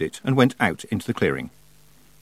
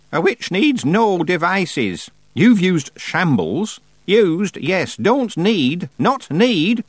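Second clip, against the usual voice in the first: put-on stern old witch; 9 semitones higher, 4 dB louder, much slower than usual. A narrator's reading. Witches row, Miss Treason.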